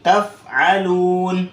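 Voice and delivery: تفعلون drawn out very slowly; slow Quranic recitation. Shown as a demonstration. Teacher models Quran word, slow careful recitation. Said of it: "اسی طرح".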